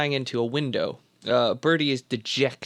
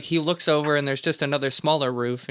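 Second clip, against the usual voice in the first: ordinary talk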